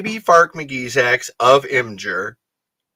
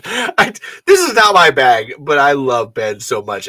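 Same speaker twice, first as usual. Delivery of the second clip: ordinary delivery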